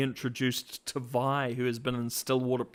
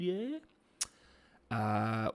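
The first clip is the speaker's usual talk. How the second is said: ordinary talk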